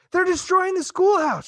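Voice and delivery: high, loud, breathless alarm; teacher panting to the sheriff, high and loud; gasping for breath. Acted. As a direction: blurt it out between gasps. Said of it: "gasping for breath".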